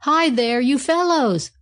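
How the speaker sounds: high-pitched monkey calling after them; mild shriek. A narrator's reading. Monkey thrown off into the snow, mildly shrieking. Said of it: "the monkey shrieked as he landed".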